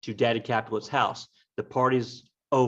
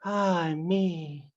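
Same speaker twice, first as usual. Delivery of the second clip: Juliet sighing; 5 semitones higher than usual. Acted. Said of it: "then she sighs. She's like".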